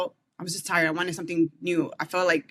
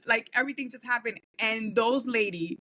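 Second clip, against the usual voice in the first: usual speaking voice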